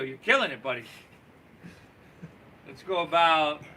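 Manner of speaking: usual speaking voice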